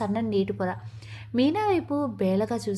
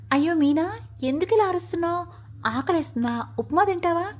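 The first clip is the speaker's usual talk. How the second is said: higher-pitched teasing call; mild calling out; 6 semitones higher than usual. A narrator's reading. Neighbour calling from his doorway to Meena, mildly shouting. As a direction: call across to her, only half raising the voice. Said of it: "గట్టిగా అరుస్తూ అడిగాడు".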